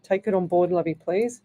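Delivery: ordinary talk, nothing marked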